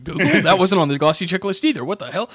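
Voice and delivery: mock the clerk's bewilderment; baffled clerk. Clerk puzzled by Google, hammed-up confusion.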